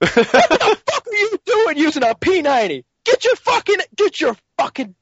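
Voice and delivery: high, loud gamer tirade; mock rage. Imitating a gamer blaming his teammate's gun choice, raging shout. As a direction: rant furiously as an imitated gamer.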